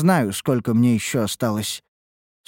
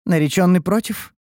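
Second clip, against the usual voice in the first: raised-eyebrow question; 7 semitones higher, 3 dB louder than usual. A narrator's reading. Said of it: "приподняла бровь Ульданте".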